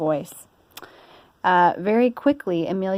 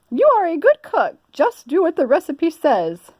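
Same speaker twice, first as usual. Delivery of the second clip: high, louder fussy lady voice; Missus Rogers; 10 semitones higher, 5 dB louder than usual. Acted. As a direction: bustle like a busy mistress. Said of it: "said Missus Rogers".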